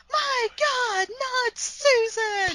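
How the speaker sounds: high, slow, drawn-out anguished cry; wailing yell. Retold or imitated. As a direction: wail it like a horror heroine. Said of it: "She just stands there and yells".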